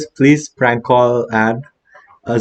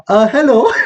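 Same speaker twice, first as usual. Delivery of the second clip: high mock hello; 9 semitones higher than usual. [name] answering phone.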